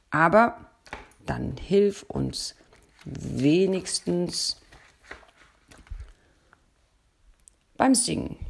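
slow, drawn-out pleading. A narrator's reading. Bear begs his friend the donkey, slowly. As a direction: stretch each word, begging. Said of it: "Der Bär sah den Esel flehentlich an".

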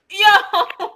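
hyped fan yelling yo; excited yell. Retold or imitated. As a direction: shout it out in shock. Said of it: "I was like".

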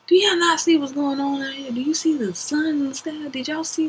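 high, amazed asking round the room; asking them in disbelief; incredulous question. Retold or imitated. Asking coworkers about strange sky.

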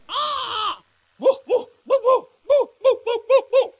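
high, loud woo woo wailing; imitated dog-like howling. Preacher imitating a possessed man's howl, high and loud. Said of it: "a guy that was crying like a dog".

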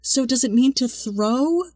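high, slow puzzled question; drawn-out, high-pitched mock confusion; mock clueless relatives. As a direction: imitate someone earnestly not getting it. Relatives quoted, failing to get it.

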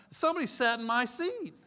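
loud, high re-enacted indignation; hammed-up loud, high protest; mock outrage. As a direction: act scandalised at the intrusion. Relives outrage over his taken pew.

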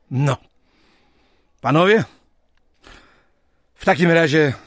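loud, high call to raise glasses; toast call. Acted. President calls the surveyors to a toast, loud and high. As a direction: summon the room for a toast.

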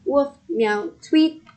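high spoken animal sounds, last one loudest; animal calls. A narrator's reading. Dog, cat, bird cheer the moving turnip, animal calls. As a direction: chirp out each animal's call in turn.

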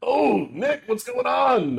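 Leskin's voice. Acted. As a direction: pitch way up, friendly. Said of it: "Leskin will just kinda like".

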